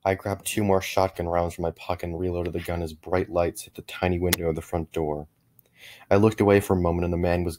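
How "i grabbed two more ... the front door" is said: usual speaking voice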